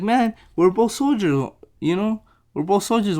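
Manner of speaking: protesting. Retold or imitated. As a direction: plead the case in a lightly acted retelling. Retold protest to a hostile guard.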